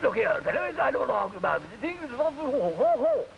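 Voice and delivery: put-on high, mumbled upper-class English accent; mumbling toff. Englishman blusters at the waiter in a toff English accent. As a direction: blather like an upper-crust Englishman. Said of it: "Man said".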